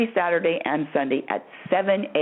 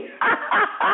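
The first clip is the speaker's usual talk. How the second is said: laugh. Real, not acted. Laughs at own uncertainty.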